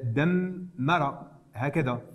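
دمر with the doubled m held, dammara, said slowly; slow pronunciation demo. Shown as a demonstration. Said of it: "دمر هكذا تكتب عادية".

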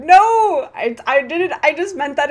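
high, loud flustered no; embarrassed protest. Real, not acted. Streamer denying chat's joke with a loud flustered shout. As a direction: blurt out a denial, caught out. Caught in a slip by chat.

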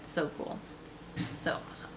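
soft awed whisper; whispered delight. Real, not acted. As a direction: breathe it out admiringly. Whispered admiration.

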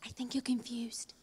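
hushed whisper into the microphone; stage whisper. Pretend. Presenter secretly correcting a confused co-host, whispered. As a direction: whisper it like a secret everyone hears. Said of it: "Why are we whispering into the microphones?".

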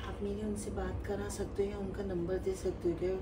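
put-on soft girly voice, fan calling; a man playing a shy girl; man doing a woman's voice. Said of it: "मैं ना आयशा बोल रही हूँ".

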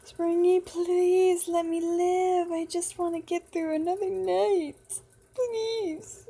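high, drawn-out begging; pleading for life. Pretend. Player begs Springy to let them live, wailing plea. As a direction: stretch the pleas out in a high whine.

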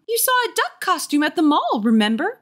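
high-pitched motherly reminder; insistent mother. Acted.

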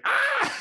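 sudden high yelp, as if his hand is being crushed; mock yelp of pain. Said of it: "I was like".

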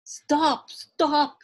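loud repeated yell, moderately high; old man's yell. A narrator's reading. Old man calls after the runaway musubi man, loud yell. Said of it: "yelled the little old man".

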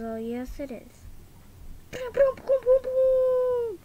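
normal talking voice

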